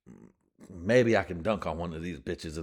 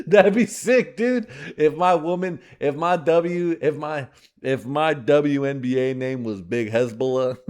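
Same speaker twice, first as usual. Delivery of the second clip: excited shout; 7 semitones higher, 9 dB louder than usual. Real, not acted. Comic cracking himself up.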